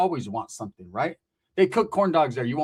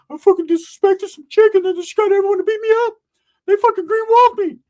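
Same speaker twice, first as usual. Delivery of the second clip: very high, loud squeaky complaint; mock whiny cousin; 8 dB louder than usual. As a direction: wail it as a caricature of a beaten-up cousin. Imitates cousin telling of his beating.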